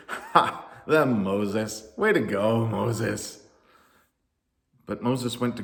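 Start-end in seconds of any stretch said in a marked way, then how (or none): 0.1-3.5 mocking laugh, then dry praise; very sarcastic. A narrator's reading. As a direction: jeer with fake congratulations. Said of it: "said very sarcastically".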